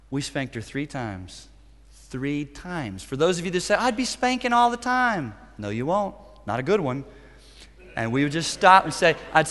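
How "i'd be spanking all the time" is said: higher-pitched objecting parent; mock protest. Preacher voicing parents who object to the advice, high mock protest. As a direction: mimic a parent's exaggerated objection.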